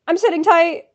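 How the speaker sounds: loud, emphatic falling reply; impatient retort. Real, not acted. Fan answering the website's order, impatient retort. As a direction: answer back firmly and loudly. Answering the site's sit tight.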